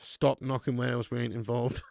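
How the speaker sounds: ordinary talk, nothing marked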